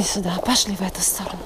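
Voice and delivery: whispered direction to the horse; whispering. Real, not acted. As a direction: softly whisper the way. Quietly guiding the horse.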